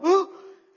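sharp, high, loud gasp of shock. Acted. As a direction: gasp as if seeing the leprous hand. Water becomes blood.

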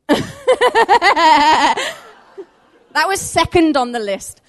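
high laugh through the words. Real, not acted. Speaker laughing at her own joke about the gift, high and giggly. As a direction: giggle it out, tickled.

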